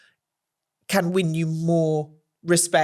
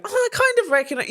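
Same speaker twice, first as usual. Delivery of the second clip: higher, faster coy reply; playful banter; 14 semitones higher, faster than usual. Retold or imitated. Bantering back with the Prime Minister, higher and coy. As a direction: play it coy and teasing. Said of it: "I was like".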